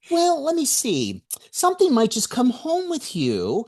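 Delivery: a light mock-ominous warning; warning a would-be ghost hunter, high and teasing; telling a would-be ghost hunter. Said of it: "I said".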